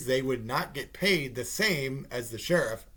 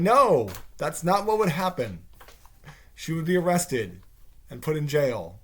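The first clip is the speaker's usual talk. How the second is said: indignant rebuttal; 4 semitones higher, 7 dB louder than usual. Acted. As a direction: object in exasperation.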